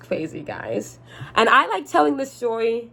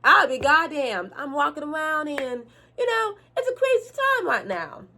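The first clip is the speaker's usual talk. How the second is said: hammed-up country accent, pitched way up; 6 semitones higher than usual. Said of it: "I'm gonna cut the accent".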